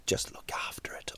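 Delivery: hushed, breathy aside to dog owners; whispered aside. Real, not acted. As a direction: drop to a whisper for the warning.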